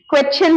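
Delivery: "क्वेश्चन" as kwet-chin; wrong pronunciation. Shown as a demonstration. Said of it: "क्वेश्चन नहीं करना है". ("क्वेश्चन" में श हटाकर क्वेच्चिन बोलें)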